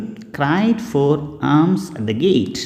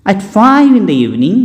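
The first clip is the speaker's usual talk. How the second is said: usual speaking voice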